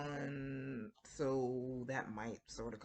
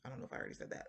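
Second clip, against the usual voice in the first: whispered aside; 2 semitones lower, 9 dB quieter, much faster than usual. Real, not acted.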